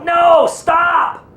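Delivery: high, urgent shout; retold yell. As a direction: yell to halt a child at the road. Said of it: "And he'd yell".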